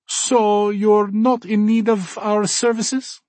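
put-on high, slow, friendly German accent; cheerful German accent. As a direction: welcome guests warmly, German accent. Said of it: "Gunther asks".

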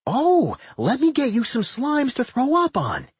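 high, eager exclaiming; excited exclamation. A narrator's reading. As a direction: pipe up high with delighted eagerness. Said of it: "Doc exclaimed".

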